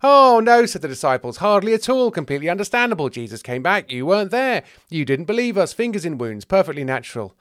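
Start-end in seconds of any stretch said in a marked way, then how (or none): 0.0-0.7 loud, high protesting denial; big, insincere-sounding "not at all"; over-hasty reassurance. Acted. Disciples hurry to reassure Thomas. 1.4-7.3 high, emphatic stream of excuses; loud protesting start, settling down by the end; over-hasty reassurance. Acted. Disciples pile on hasty reassurances.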